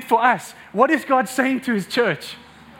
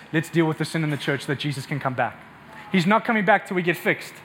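low, muted monotone; voicing a mistaken view; 8 semitones lower, 5 dB quieter than usual. Preacher quoting the church's mistaken thinking, low monotone. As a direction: drop low and soft, reciting someone else's view. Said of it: "we think making the bride ready means this".

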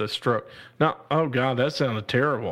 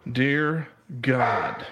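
ordinary talk

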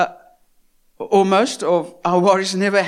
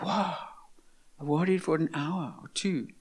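much softer, weary aside; rueful tired thought; 4 semitones lower, 11 dB quieter than usual. Retold or imitated. Preacher voicing a sleepless worrier, softly weary.

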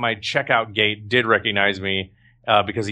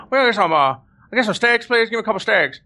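high-pitched put-on manager voice; Kenny, the manager; 9 semitones higher than usual. Prankster ordering steaks as his manager, high impersonation. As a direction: impersonate the boss, pitched well up. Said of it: "He talked like that".

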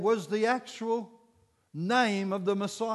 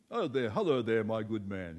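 low English accent imitation; English gent; 8 semitones lower than usual. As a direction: greet like a stuffy English gentleman. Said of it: "one of those Englishmen".

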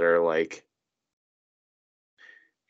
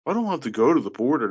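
much higher, fast disbelief; exasperated; 10 semitones higher, faster than usual. Real, not acted. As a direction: vent the outrage. Exasperated rant.

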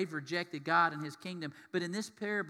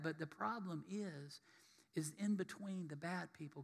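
ordinary talk

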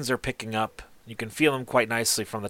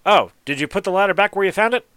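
higher and louder questioning tradesman; artisan's voice; 9 semitones higher, 5 dB louder than usual. Acted. Artisan asking the stranger about his ladder, raised voice. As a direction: ask it pointedly, voice pitched up. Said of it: "He goes".

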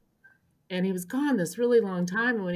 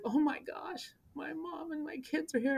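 overwhelmed; 9 semitones higher, 6 dB quieter than usual. Retold or imitated. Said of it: "And I started crying".

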